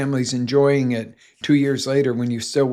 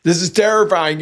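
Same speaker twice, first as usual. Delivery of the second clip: higher and louder mock fright; mock terror; 6 semitones higher, 7 dB louder than usual. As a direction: play the scared rider, loud and high. Retelling roller coaster fear.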